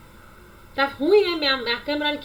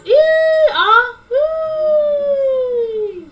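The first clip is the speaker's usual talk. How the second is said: a long, high "Ih" sound; high drawn "Ih"; 8 semitones higher, 3 dB louder, much slower than usual. Shown as a demonstration. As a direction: hold a high exclamation. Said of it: "Quando eu faço". ("Ih" agudo e prolongado)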